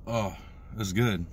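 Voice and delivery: ordinary talk, nothing marked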